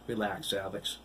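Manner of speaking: ordinary delivery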